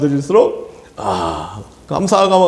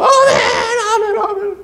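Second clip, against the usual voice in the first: ecstatic high shouted amens; shouting amen. Retold or imitated. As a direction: cry out amen with all your joy. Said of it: "목사님 말씀이 들어".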